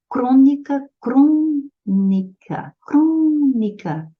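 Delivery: "Crônica" com o CRÔ alto e esticado; sílaba tônica destacada. Shown as a demonstration. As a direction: separe "Crônica" alongando bem a primeira sílaba. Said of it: "é a antepenúltima sílaba que é tônica". ("crônica" com ênfase aguda e arrastada no CRÔ)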